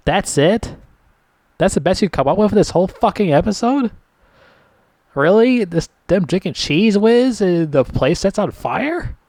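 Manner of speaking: real disbelief, pitched high and rising; incredulous. Real, not acted. Reviewer reacting to the episode's ending, incredulous. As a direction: push it up into outraged questions.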